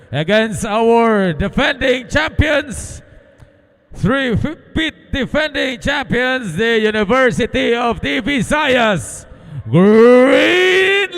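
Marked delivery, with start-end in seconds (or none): none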